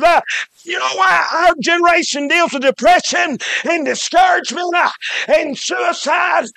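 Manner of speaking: ringing preacher's call with a hey at every turn; full-force preaching. Acted. Preacher warning the congregation about envy, in full-force preaching. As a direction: drive it home to the pews like a revival preacher.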